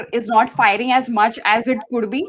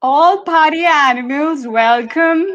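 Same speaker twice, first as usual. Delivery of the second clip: hesitant Birmingham try, slow and higher; attempted Birmingham accent; 4 semitones higher, 3 dB louder, slower than usual. Shown as a demonstration. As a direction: give the Birmingham accent a go, slowly. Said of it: "Go for it, [name]".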